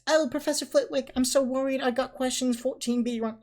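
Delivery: high, loud mocking falsetto; put-on high voice. Ron mimicking Hermione fretting to Flitwick, high voice. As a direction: pipe up in an exaggerated worried squeak. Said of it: "He put a, on a high voice".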